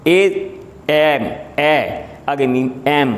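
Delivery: ایم کو آہستہ، اے کی آواز سے شروع کر کے; ایم، پہلے اے پھر میم، ٹھہر ٹھہر کر; slow spelled sounds. Shown as a demonstration. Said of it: "لیکن آواز کیا ہے؟". (ایم کی آواز اے سے شروع، آہستہ)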